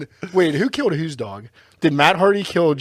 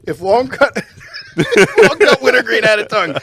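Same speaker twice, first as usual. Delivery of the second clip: high, loud, through laughter; cracking up; 8 semitones higher, 7 dB louder than usual. Real, not acted. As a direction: struggle to repeat it while laughing. Cracking up at the joke.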